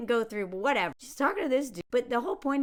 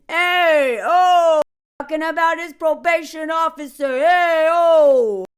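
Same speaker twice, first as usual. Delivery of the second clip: loud, higher mock hollering; boisterous heckling; 5 semitones higher, 11 dB louder, slower than usual. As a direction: holler like a rowdy guy barging in. Acting loud guy who comes in.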